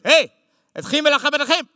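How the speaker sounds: high, loud Hebrew command; soldier's Hebrew bark. Retold or imitated. Israeli soldier at the stairs barking an order at the comic. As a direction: bark it like a soldier stopping him. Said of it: "soldier looks at me and goes".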